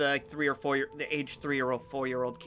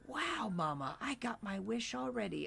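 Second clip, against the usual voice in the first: put-on high, hushed awestruck kid voice; soft little-kid wonder, fading to a whisper; small child; 8 semitones higher, 12 dB quieter than usual. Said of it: "you hear the kid say".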